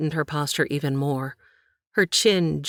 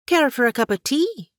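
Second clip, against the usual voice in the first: Katie's voice; 10 semitones higher, 4 dB louder than usual. Acted. Katie offers tea, hiding nerves.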